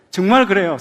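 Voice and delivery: ordinary delivery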